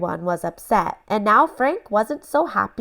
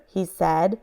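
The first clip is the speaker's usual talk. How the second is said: ordinary delivery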